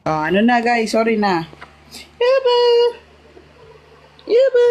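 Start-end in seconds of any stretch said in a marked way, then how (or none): 2.2-2.6 calling out. Real, not acted. Calling out to someone. 4.2-4.6 calling out. Real, not acted. Calling out to someone.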